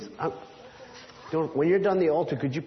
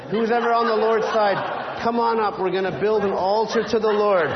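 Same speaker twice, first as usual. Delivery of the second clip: put-on rally the kids to come up front; calling out; 5 semitones higher, 5 dB louder than usual. Elijah calling helpers to build the altar, loud rallying call.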